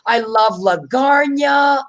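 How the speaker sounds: "lasagna" said wrong as la-GARN-ya; gushing, drawn-out "lasagna" as luh-GARN-yuh; mispronounced lasagna. Retold or imitated. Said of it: "they'll go like this".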